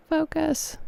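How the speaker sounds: high pleading plea; pleading squeak. Real, not acted. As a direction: beg the camera in a squeaky voice. Begging camera to focus.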